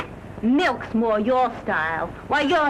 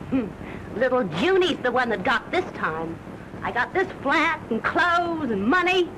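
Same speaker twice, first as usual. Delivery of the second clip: ordinary delivery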